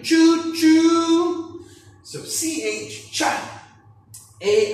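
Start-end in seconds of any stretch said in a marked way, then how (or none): 0.0-1.7 high, loud train whistle imitation; playful choo choo train call. Shown as a demonstration. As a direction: toot it like a train. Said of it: "like a train".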